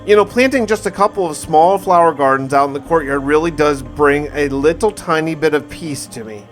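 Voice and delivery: ordinary talk, nothing marked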